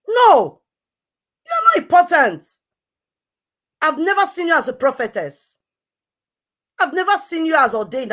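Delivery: loud, high, furious shouting. Real, not acted. Ranting at the self-styled prophetess, furious shout.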